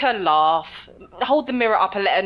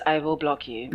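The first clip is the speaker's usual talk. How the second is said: lower, deadpan echo of a threat; very straight voice; 4 semitones lower, 3 dB quieter than usual. Retold or imitated. Guest echoing the host's warning to fans, very straight voice. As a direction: imitate a straight-faced warning. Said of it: "said that with a very straight voice".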